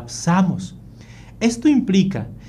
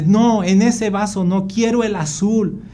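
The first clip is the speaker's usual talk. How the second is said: put-on higher, insistent child's voice; demanding child; 5 semitones higher than usual. Son rejecting dad's glass, fussy and high-pitched. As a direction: refuse like a fussy little kid.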